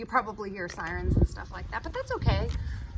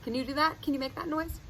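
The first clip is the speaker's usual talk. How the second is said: ordinary delivery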